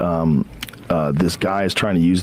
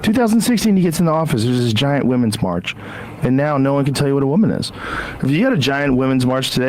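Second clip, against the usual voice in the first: ordinary delivery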